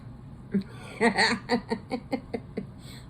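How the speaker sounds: breathy cackle of laughter; real giggling fit; amused laugh. Real, not acted. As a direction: laugh it out in a long run. Amused by own cookie joke.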